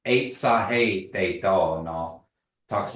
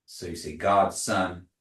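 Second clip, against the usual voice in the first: usual speaking voice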